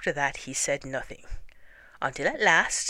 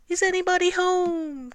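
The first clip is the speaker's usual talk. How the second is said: very loud call; 14 semitones higher, 4 dB louder than usual. A narrator's reading. Pooh calls into the hole once more, very loud high call. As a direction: call out insistently with long vowels. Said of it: "called out Pooh very loudly".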